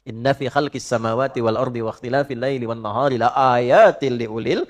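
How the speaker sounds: steady chanted verse; Quran recitation. Acted. Preacher reciting the ulul albab verse, chanted. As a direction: intone the Arabic in one breath.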